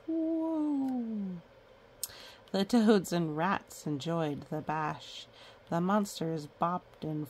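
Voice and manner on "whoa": playful whoa. Real, not acted. Reacting to page.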